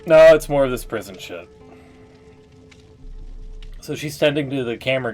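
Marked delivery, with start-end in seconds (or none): none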